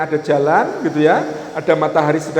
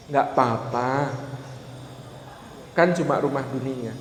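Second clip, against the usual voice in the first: gentle reassurance; 5 semitones lower, 9 dB quieter than usual. Acted. Wife consoles husband over their small rented house, gentle reassurance. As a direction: soothe softly, taking your time.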